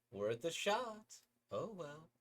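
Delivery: put-on chirpy girl inviting a friend; high sweet girl asking eagerly; cheerful girl voice.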